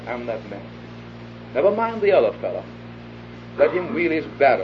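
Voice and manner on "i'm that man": lower, much softer confession; quiet confession. Shown as a demonstration. Preacher models the seeker's confession, soft and low. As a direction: let it fall to a near hush. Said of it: "to say".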